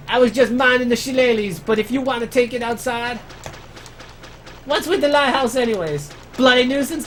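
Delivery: high, put-on Australian bloke voice; Australian accent. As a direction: play a cranky Australian neighbour. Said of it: "for those who don't speak Australian".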